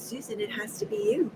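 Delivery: soft, calm quoted reply; gently and calmly deliver a verdict. Retold or imitated. God answering her protest, very calm and soft. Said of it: "He just very calmly said".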